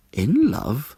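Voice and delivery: rising, startled question; a touch of surprise; surprised. Acted. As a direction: Frog caught off guard.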